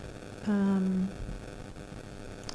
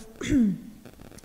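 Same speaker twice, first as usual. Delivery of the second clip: quick throat clear. Real, not acted. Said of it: "losing my voice here".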